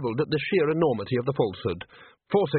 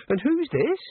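put-on happy young woman; 13 semitones higher than usual. A narrator's reading. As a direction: greet her like a delighted hostess. The girl greeting Nanny.

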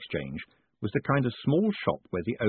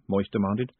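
plain reading voice

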